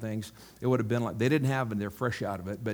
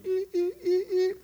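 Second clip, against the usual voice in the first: very high repeated little squeaks; imitated ankle squeaks. The lady's healed ankle moving, imitated with high squeaks. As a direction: squeak like a stiff joint starting to move. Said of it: "The lady's ankle goes".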